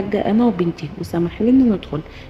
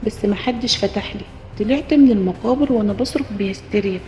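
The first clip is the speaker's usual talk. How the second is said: plain reading voice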